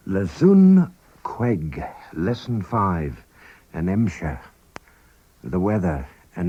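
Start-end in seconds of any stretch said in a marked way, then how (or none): 0.1-1.8 slow teaching demo. Shown as a demonstration. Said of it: "teacher slows the phrase".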